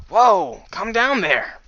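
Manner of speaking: high, loud call; urgent shout. Acted. Companion calls off the griffin, urgent high shout. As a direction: shout to halt an attacker.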